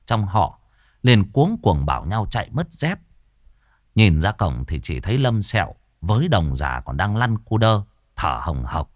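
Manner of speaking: usual speaking voice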